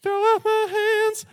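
very high, slow, sung-out praise; mock worship cry. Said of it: "came up to me and is like".

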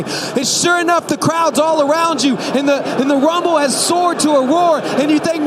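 high, very loud sermon narration; impassioned preacher over a roaring crowd; full-force preaching. Acted. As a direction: drive the story to a climax at full volume.

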